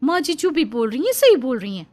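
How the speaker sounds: ऊँची आवाज़ में तुनकती जवान बहू; सास का पक्ष लेकर पड़ोसन को ऊँची आवाज़ में ललकारो; बहू प्रिया की आवाज़. Acted. (पड़ोसन से भिड़ती बहू, ऊँची तीखी आवाज़ में)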